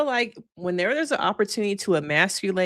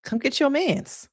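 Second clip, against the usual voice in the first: lightly imitated call-out, higher pitched; Courtney; 6 semitones higher than usual. Host imitating Courtney summoning Caitlyn, lightly higher-pitched mock call. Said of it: "And so Courtney's like".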